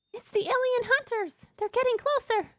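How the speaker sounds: very high, alarmed character voice; Ella. Acted. Ella warns of alien hunters, high alarmed voice. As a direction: squeak out an urgent warning.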